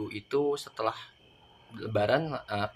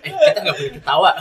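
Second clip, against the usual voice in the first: stifled giggles; 6 semitones higher, 11 dB louder than usual. Real, not acted. Still laughing.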